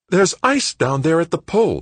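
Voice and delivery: put-on high, loud boyish excitement; bursting to share big news; brimming with enthusiasm. A narrator's reading. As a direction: pitch the idea eagerly. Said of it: "brimming with enthusiasm".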